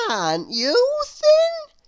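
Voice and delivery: put-on very high, slow, delighted little girl voice; Little Miss Contrary. A narrator's reading. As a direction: gush over the room as if thrilled. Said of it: "she said to Mister Greedy".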